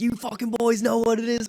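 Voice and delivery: high, excited call-out to rival fans; hyped fan. Retold or imitated. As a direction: hype up the crowd. Hyped fan yelling at game.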